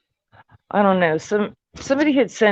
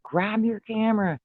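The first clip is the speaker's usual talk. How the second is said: excited call; 7 semitones higher than usual. Retold or imitated. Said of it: "he's like".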